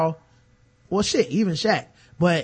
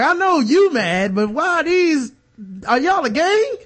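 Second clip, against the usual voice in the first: rising, higher voice of disbelief, slow at first; mock incredulous question; 7 semitones higher than usual. To the angry kids, higher mock disbelief. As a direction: ask if they're a gang in disbelief.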